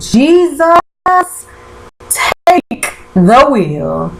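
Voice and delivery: high cry of Jesus, then a very slow take the wheel; mock exasperated plea. Vlogger reacting to a show twist, slow mock prayer. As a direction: throw up your hands and drag out the wheel. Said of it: "I said".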